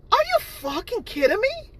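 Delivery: high-pitched furious disbelief; incredulous outrage. Real, not acted. Vlogger answering the haters' lie, high and outraged. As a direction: fling it back at them, stunned.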